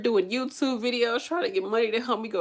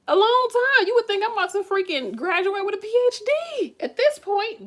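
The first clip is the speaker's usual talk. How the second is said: much higher and louder, fed-up exclamation; exasperated; 13 semitones higher, 4 dB louder than usual. Real, not acted. To her viewers about endless schooling, exasperated and high. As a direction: let the frustration burst out.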